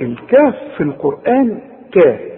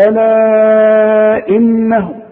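very slow chanted ألا إنه, held and louder; Quran recitation; 5 semitones higher, 4 dB louder, much slower than usual. Shown as a demonstration. Teacher reciting for the student in a chanted style. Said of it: "هنقول".

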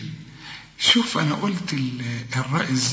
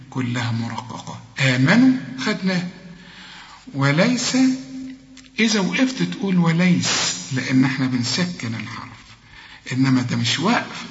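ordinary talk, nothing marked

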